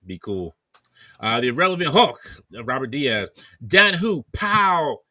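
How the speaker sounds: big booming name callouts; loud shoutouts. Real, not acted. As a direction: announce each name loudly. Loud super chat shoutouts.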